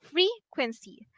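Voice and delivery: "frequency" with FRE lifted and stressed; stress on first syllable. Shown as a demonstration. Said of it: "have the stress on the first syllable".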